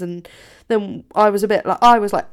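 ordinary talk

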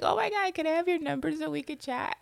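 playful high squeal, teasing her own overexcitement; mock gushing. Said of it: "I was like".

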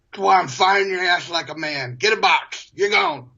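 muffled mock manager, climbing in pitch; cotton-ball-cheeked boss. General manager firing his young salesman, cotton-mouthed bluster. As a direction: fire him through cotton-stuffed cheeks. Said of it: "spoke like he had cotton balls in his cheeks".

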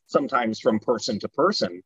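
ordinary talk, nothing marked

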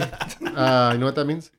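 normal talking voice

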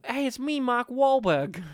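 Mark Wahlberg. Retold or imitated. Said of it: "'Cause he's a Bostonite".

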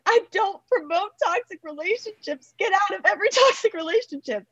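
high-pitched, mock-distressed plea; retold tearful protest. Singer retells her guilty reaction to the judge's comment, mock tearful. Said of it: "felt so guilty and was crying".

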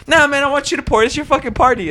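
put-on high, pushy drunk coworker voice; coworker Isaac. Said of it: "He's like".

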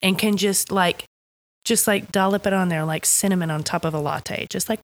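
ordinary delivery

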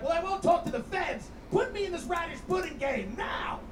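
mock-unhinged rant at a baby food interviewer; aggressive rant. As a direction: go off at the hipster interviewer. Comic acts out his aggressive self.

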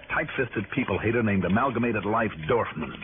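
low, dry first-person narration; hard-boiled detective narrating; wry narration. Acted. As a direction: tell the story back to the listener, sardonic. Hero narrates his own story.

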